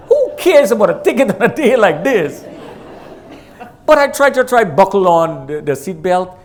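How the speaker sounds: amused chuckling delivery; laughing. Real, not acted. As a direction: laugh it off as you speak. Laughing at his own ticket worry.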